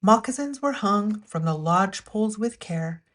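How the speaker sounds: ordinary delivery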